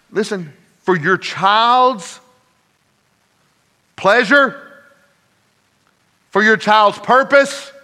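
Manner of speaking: high, insistent preacher voice with weighty pauses; emphatic preaching. Real, not acted. Preacher explaining cabinet locks for a child's safety, emphatic preaching. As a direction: build the list phrase by phrase.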